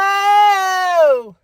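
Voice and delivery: high excited whoa shout. Real, not acted. Fan shouting whoa at Cody's entrance, high and loud. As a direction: cry out high and loud in surprise.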